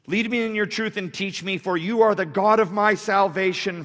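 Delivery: loud, high preacher's reading; full-force scripture declamation. Acted. Preacher reading David's prayer aloud, full-force preaching. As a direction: proclaim the verse to the room.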